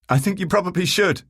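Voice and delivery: loud, high, amused call; shout up. A narrator's reading. Man telling his brother to go back to bed, shouting. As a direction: call up laughing. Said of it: "I shout up, laughing".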